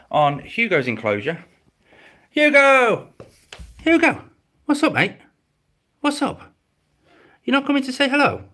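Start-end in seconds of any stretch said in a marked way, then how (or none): 2.3-4.2 high, loud call to a lizard; calling the pet. Real, not acted. Calling his lizard by name. 4.6-8.4 much higher, sing-song pet voice; pet talk. Real, not acted. Cooing at his pet lizard.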